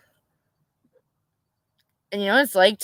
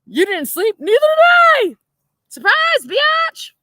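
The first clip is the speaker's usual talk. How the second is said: very high, loud sarcastic squeal; hammed-up mock shout, stretching the last words; mocking comeback; 5 dB louder than usual. Jailed wife to her sleepless husband, mocking shrieked comeback.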